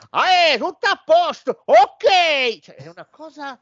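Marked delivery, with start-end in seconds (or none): 0.1-2.6 put-on very high, loud English-accented Italian. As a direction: chirp it like an Englishman. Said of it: "che parla tutto così per tutto il film".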